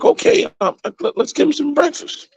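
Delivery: squeaky high cartoon pig agreeing; high-pitched pig agreeing to breakfast. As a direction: voice the pig way up high, going along with it. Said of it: "So the pig is like".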